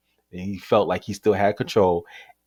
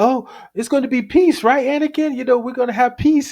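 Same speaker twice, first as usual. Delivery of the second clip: high-pitched imitation, seeking agreement; mock pleading. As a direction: put on a high voice to placate someone. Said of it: "And he said".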